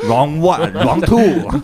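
slow loud round announcer; game announcer. Pretend. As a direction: call the rounds like a fighting game.

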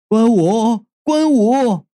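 shouting for a vanished brother in the dark; calling out. A narrator's reading. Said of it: "就叫道". (焦急地高声呼喊名字)

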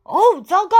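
loud, high cry of alarm; exclaiming. Acted. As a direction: yelp like a startled dad. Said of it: "艾米的爸爸叫道".

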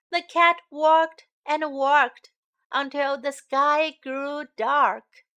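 gentle storybook reading; singsong storytime narration; reading to children. A narrator's reading. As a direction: read it like a bedtime picture book.

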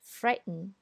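American frighten with a glottal t; American English. Shown as a demonstration. Teacher reads frighten in an American accent. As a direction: frighten said the American way, t swallowed before the n. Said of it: "再读美音".

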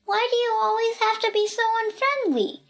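put-on high-pitched little-girl complaint; reproachful. A narrator's reading. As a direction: chide him in a high, hurt child's voice. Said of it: "said Pee-Pee reproachfully".

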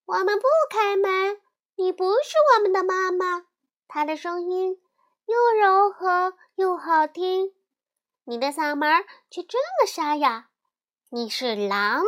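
高声尖细的小羊, 大声拒绝; 小羊们齐声嚷着不开门; 小羊们一起叫起来. A narrator's reading. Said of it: "一起叫起来". (小羊们隔着门高声冲狼嚷嚷)

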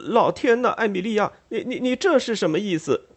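high, stammering, alarmed cry; a fat dandy caught off guard, stuttering; flustered brother. Acted. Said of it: "认真着急起来，嚷嚷道".

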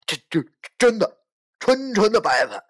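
loud, high flustered stammer; panicked stammer. Acted. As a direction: rush it out in a panic. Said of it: "慌忙地说着".

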